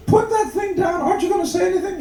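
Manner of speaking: very high falsetto wife; impatient wife. Acted. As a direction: nag in a mock woman's falsetto. Voices impatient wife in joke.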